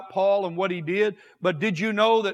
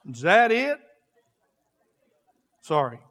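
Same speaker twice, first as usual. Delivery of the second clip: ordinary talk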